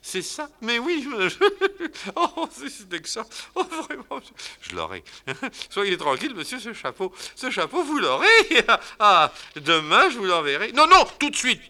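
very high, giggling through the words; laughing baroness. Acted. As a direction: titter with delight. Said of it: "Elle s'en va en riant".

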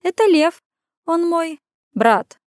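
female character voice. Acted. Sister introducing brother.

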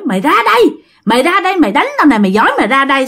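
high-pitched ghostly taunt, repeated challenge; acted scolding. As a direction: imitate the ghost daring her to come out. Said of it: "bà cứ đứng, bà chửi".

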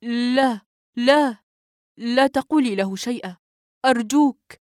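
a rushed, pleading no; quick and emphatic. A narrator's reading. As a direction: cut her off fast, insisting. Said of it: "قلت بسرعة وتأكيد".

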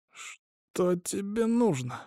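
soft, high, drawn-out wheeze; wheezed question. A narrator's reading. Hostage with a syringe in his neck, wheezing. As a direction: squeeze the words out slowly and quietly. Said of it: "просипел Айзатов".

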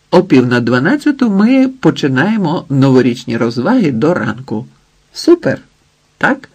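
animated MC rallying guests; lively party host. Acted. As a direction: announce the programme to the crowd with big swoops.

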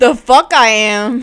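loud, punchy mock outrage; hammed-up indignant retort; mock disbelief. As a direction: blurt it loudly in mock disbelief. Mimics the character's outraged disbelief.

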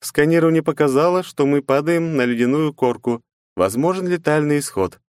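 emotionless AI. Acted. AI voice continues.

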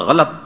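غلط in two syllables, "gha-lat"; correct pronunciation. Shown as a demonstration. Cleric repeating the right form, غلط as "ghalat". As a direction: sound both short vowels of غلط. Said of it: "صحیح تلفظ کیا ہے؟".

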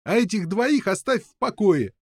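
high-pitched demand; defiant prisoners. A narrator's reading. Prisoners defending the two captives, high defiant demand.

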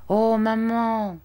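high, drawn-out plea to mother; child's appeal. A narrator's reading. Arthur appealing to his mother for the hungry guests, pleading. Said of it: "La dame comprit cet appel".

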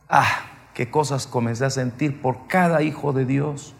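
ordinary delivery

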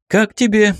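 high, pleased-with-himself question; self-satisfied, pitched well up; smug. A narrator's reading. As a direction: ask it with undisguised self-satisfaction. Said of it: "не скрывая самодовольства".

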